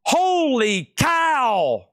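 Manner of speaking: long, stretched-out high exclamation; amazed. Real, not acted. Host marvels at the bid to viewers, stretched out and high. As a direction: drag out the wonder at the price. Gasps at a high auction price.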